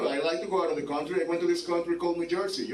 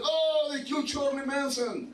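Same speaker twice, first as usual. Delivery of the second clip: mock Biden; 9 semitones higher than usual. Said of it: "He would have been like".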